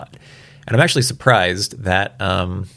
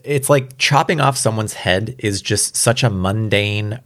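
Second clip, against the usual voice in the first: ordinary talk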